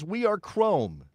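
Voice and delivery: hammed-up solemn proclamation; mock-grand slogan. Shown as a demonstration. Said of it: "you cannot say this with a straight face".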